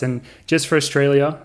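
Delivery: normal talking voice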